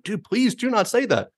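high, small mock plea; high pleading. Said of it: "And you're like".

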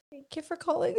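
soft, mumbled phone greeting; shy first try at the store greeting; timid greeting. Retold or imitated. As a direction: say it like a nervous new hire. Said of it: "No, you have to sound way more excited".

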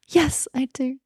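high, breathy, thrilled "yes, I do"; gasp, then answer all lit up. Retold or imitated. Accepting her boyfriend's question, gasping with delight.

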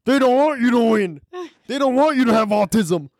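lightly mocking celebrity impression. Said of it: "That's what [name] does".

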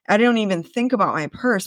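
ordinary delivery

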